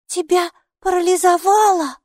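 scared for a friend; frightened. A narrator's reading. Girl frightened the robe is paralysed, alarmed. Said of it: "испуганно выпрыгнула".

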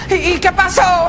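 put-on high, slow, drawn-out child question; curious little boy. Boy prodding dad for the story, childlike. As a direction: ask eagerly like a kid. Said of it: "el niño".

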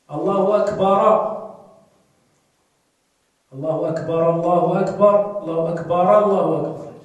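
mock wrong takbir; wrong adhan. Shown as a demonstration. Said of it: "وهناك من يقول".

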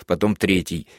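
plain reading voice

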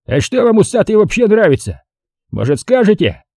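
high-pitched goading questions; picking a fight. A narrator's reading. Drunk colonel to the men next table, provoking. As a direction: needle them, pitched high then dropping. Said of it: "Жора явно задирался".

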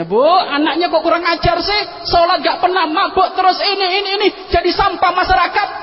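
high, loud mocking complaint; villagers scolding. Villagers yelling at the mother about her son, angry shout.

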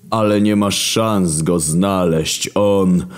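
God drawling each word, very slow; slow menacing murmur. Acted. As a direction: Creator muses darkly over love. God dismisses narrator.

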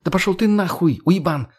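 high, angry snap; snapping back. A narrator's reading. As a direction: snarl the insult back. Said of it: "огрызнулась она". (огрызается высоким голосом)

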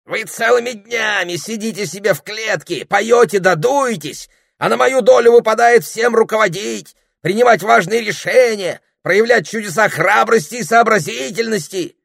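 high, loud, put-upon squawk; the parrot lecturing about all his hard work; ranting parrot. Acted. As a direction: complain like an overworked, self-important parrot.

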